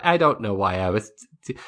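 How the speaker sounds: normal talking voice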